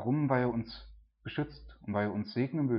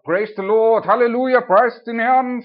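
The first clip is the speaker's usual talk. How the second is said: mock glorious hero; 10 semitones higher, 12 dB louder than usual. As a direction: ham up a triumphant stage preacher crying praise. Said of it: "als glorreicher Held vor der Menge". (spöttisch nachgeahmter Showprediger, viel höher, lauter und langsam)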